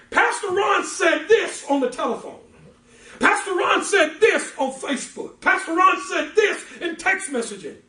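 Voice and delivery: high, loud, jeering rumour-monger; mocking gossip shout. Gossips spreading rumours about the pastor, loud high mocking shout. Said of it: "That's why people say".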